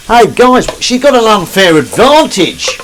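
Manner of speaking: high, loud mock New Zealand accent; hammed-up New Zealand accent. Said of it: "or whatever a New Zealand accent might be".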